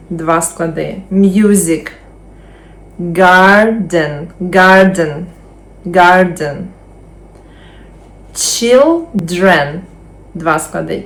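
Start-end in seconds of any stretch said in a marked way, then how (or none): 1.1-9.8 slow, careful model words; first-syllable stress drill. Shown as a demonstration. Teacher drilling words for students to repeat. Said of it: "повторюємо за мною".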